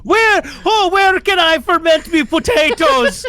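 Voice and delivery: loud, high mock-Irish villager; hammed-up Irish. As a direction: wail it in a cartoon Irish accent. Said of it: "I don't know what accent I'm doing".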